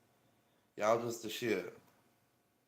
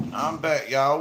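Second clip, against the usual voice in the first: calls out I'm back, louder; loud greeting; 4 semitones higher, 9 dB louder, slower than usual. Real, not acted. Streamer back on camera greets viewers, loud call. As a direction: announce the return to the whole chat.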